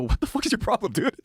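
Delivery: high-pitched retold protest; incredulous high-pitched comeback, played for laughs; mock indignation. Indignant reply in retold argument with alien.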